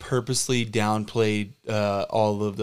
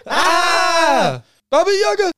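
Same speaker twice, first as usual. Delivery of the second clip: much higher, louder shriek of terror; terrified scream; 9 dB louder, much slower than usual. Acted. Facing Baba Yaga, terrified shriek. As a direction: scream as if the witch appeared.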